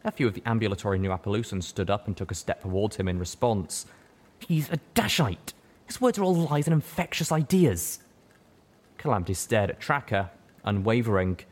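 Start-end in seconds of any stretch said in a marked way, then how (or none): 4.4-8.0 high, loud denouncing buck; accusing high stallion. Acted. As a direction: denounce him to the crowd. Tracker denounces.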